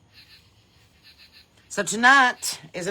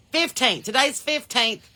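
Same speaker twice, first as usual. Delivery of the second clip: "Fifteenth" said higher and firmly, falling at the end; insisting correction; 7 semitones higher, 6 dB louder, slower than usual. Real, not acted. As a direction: correct her, pressing on "Fifteenth". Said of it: "you've been saying it's the fourteenth".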